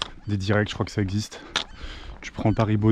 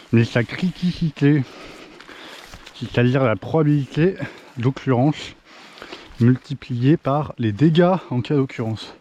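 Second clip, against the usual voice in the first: slow, deliberate, spelled-out definition; slow lecturing; 3 semitones higher, slower than usual. Real, not acted. Hiker explaining risk to viewers, slow lecturing delivery. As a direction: lay out the risk formula word by word like a teacher.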